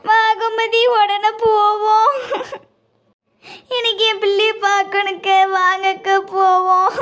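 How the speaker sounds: slow, weeping pleas to go; desperate to see her child; sobbing, urgent; crying urgency. Acted. Mother urging, crying.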